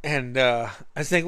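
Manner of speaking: normal talking voice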